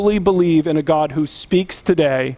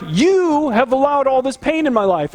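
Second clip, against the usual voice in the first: higher-pitched, mildly indignant complaint; mad at God; 7 semitones higher than usual. Acted. Believer blaming God for the pain, mildly angry. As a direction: accuse God with a touch of mock outrage. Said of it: "then we get mad at God".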